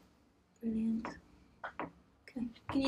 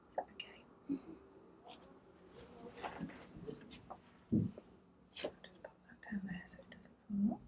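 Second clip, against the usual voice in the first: whispering; 17 dB quieter than usual. Real, not acted. Quiet talk during palpation.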